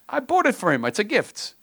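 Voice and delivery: put-on high, whiny kid voice for the son; mock whiny son. Preacher mocking son's complaint in a high whiny voice. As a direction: squeak it out like a put-upon teenager.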